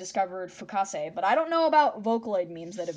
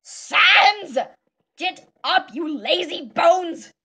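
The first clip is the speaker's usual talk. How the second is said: much higher and louder exasperated shout; Papyrus yelling; 10 semitones higher, 13 dB louder, much slower than usual. Acted. Papyrus yelling at Sans to wake up, loud and high. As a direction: brother scolding a lazy sibling. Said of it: "now Papyrus".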